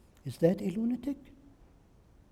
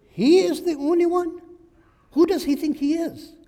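much higher, incredulous "He is the only one?"; mock outrage; 10 semitones higher, 4 dB louder than usual. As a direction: scoff in disbelief at the arrogance. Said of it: "Samir is really conceited".

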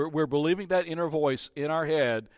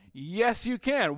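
much higher coaxing reply; encouraging parent; 7 semitones higher, slower than usual. Acted. Parent answering the child's protest, coaxing. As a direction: gently insist the child can.

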